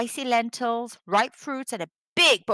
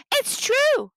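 much higher, louder insisting; a boy protesting to his mother; insistent protest; 14 semitones higher, 5 dB louder than usual. A narrator's reading. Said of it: "argued Reme".

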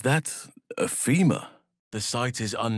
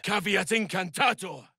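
spell incantation; 10 semitones higher than usual. Acted.

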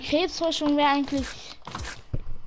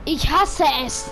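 angry frustrated; 4 semitones higher, 6 dB louder than usual. Real, not acted. Frustrated gamer rant.

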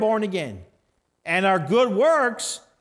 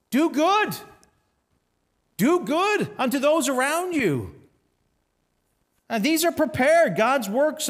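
fervent preaching; 5 semitones higher than usual. Acted. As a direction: exhort the congregation to do good. Preaching at full force.

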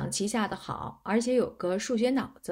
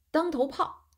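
called out at a higher pitch; mild, higher-pitched call; 6 semitones higher than usual. A narrator's reading. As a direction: announce the chess move aloud. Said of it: "嘴里叫着".

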